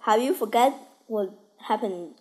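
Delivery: ordinary talk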